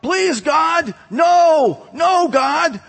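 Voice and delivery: retold panicked shout, pitched high; desperate cry to God. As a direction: yell it like sinking in the mud, pleading. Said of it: "you yelled out".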